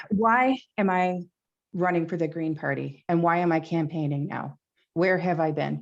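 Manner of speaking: ordinary talk, nothing marked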